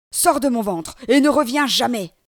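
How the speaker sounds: loud commanding monster voice; furious demon ordering the boy out of his belly; angry demon. Acted. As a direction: order him out with force.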